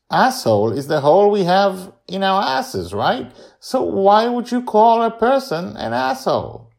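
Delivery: put-on high, loud child arguing her point; insistent young Joy. A narrator's reading. Girl reasons out a curse word to grandparents, high and insistent. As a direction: argue it like an indignant kid.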